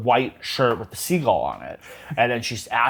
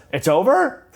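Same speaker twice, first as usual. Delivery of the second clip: pitched up high and louder, rising; high surprised question; 8 semitones higher, 4 dB louder than usual. Retold or imitated. Cher misunderstands the instructor, high rising surprise. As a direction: sound shocked and hopeful. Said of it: "she goes".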